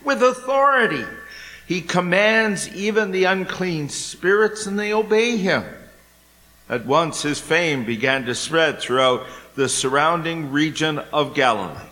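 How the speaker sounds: solemn, measured scripture read; gospel reading. Acted. Priest reading the gospel at Mass, measured. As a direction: proclaim the gospel to the congregation.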